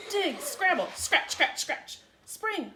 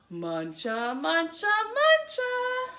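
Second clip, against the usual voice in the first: building munch; 7 semitones higher, 6 dB louder, slower than usual. A narrator's reading. Munching crescendo.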